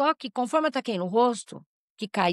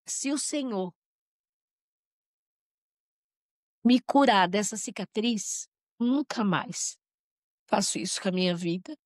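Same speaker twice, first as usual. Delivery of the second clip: emotional prayer. Real, not acted. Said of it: "Essa eu vou me emocionar".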